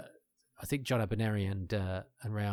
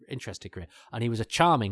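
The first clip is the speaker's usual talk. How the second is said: ordinary delivery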